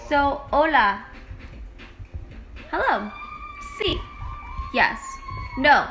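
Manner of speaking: lightly Mexican-accented Spanish words. Shown as a demonstration. Teaching Spanish basics, a light Mexican accent. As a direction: say hola like a Mexican. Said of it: "make it as Mexican as I can".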